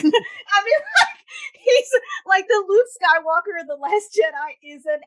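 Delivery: high, loud, laughing rant; cracking up while insisting; laughing exasperation. Real, not acted. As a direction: squeal it through laughter. Laughing while roasting the character.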